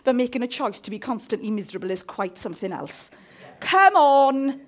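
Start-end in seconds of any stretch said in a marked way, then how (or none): 3.6-4.6 loud coaxing; loud come on, come on; rallying call. Real, not acted. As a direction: rouse the congregation. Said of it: "Be with me here now".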